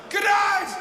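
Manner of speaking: high, loud shouted farewell; panicked sign-off. Retold or imitated. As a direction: yell it as you bolt offstage. Fleeing stage under thrown bottles.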